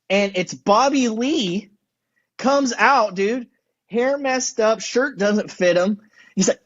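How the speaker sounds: normal talking voice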